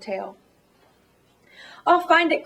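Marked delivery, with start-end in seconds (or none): 1.9-2.4 higher, louder determined cry. Acted. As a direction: cry it out, resolved. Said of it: "cried Horton".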